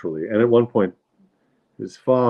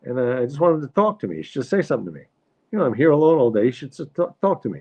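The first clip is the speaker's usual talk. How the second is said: higher-pitched lonely-wife impression; complaining mother; 6 semitones higher, faster than usual. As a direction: plead a wife's grievance, New York style. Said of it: "She says".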